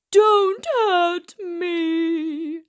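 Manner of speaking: a big lion begging a mouse; whimpering lion. A narrator's reading. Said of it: "he whimpered".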